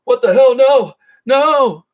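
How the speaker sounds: high, loud panicked yelps; hammed-up disgust turning to alarm; mock panic. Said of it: "he's like".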